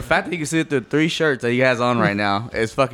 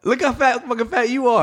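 much higher, mocking tone; 11 semitones higher than usual. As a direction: parrot his insult back squeaky. Mimicking friend's insult back.

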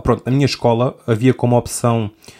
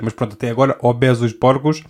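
normal talking voice

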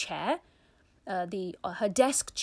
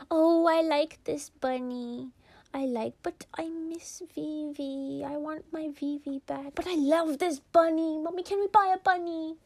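put-on wistful little girl; 7 semitones higher than usual. Sister cuddling the bunny.

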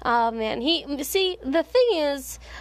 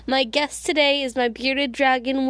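normal talking voice

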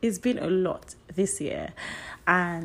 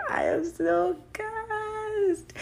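much higher, slow, drawn-out squeal; thrilled squeal; 14 semitones higher, much slower than usual. Real, not acted. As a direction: squeal it out with delight. Thrilled about anniversary.

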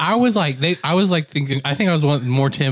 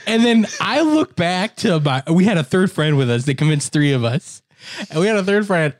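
usual speaking voice

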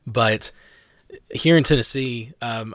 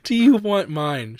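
put-on sweet girl offering; 9 semitones higher, 6 dB louder than usual. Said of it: "and says".

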